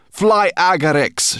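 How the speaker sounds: high, loud, spat-out creature voice. A narrator's reading. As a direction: hurl the words like insults. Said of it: "she spat".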